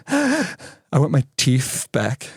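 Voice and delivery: put-on slow, hitching child's plea through tears; a crying boy begging for his teeth; sobbing child. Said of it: "the hyperventilating cry".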